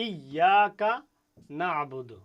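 ইয়াকা slow and loud, the doubled ইয়া pressed; stressed doubled ya. Shown as a demonstration. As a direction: lean hard on ইয়াকা. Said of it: "একটু pressure দিতে হবে".

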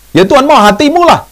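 high, loud insistent answer; emphatic retort. Retold or imitated.

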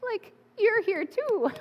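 high, slow, laughing wonder; like greeting an old friend, amazed; breathless awe at the sunrise; awed surprise. Retold or imitated. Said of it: "And I was like".

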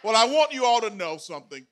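ordinary talk

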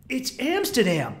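high, drawn-out mock exasperation; exasperated. Froyo-eating dealer to hesitant tourist, mock exasperated. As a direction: protest as if it were obvious, mildly mad. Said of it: "he's getting really mad at me".

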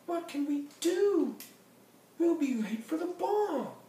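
very high, loud character wail; dismayed Berlioz. Acted. Berlioz to the band, wagon stuck, high dismayed cry. Berlioz frets over being late.

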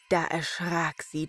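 ordinary delivery, nothing marked

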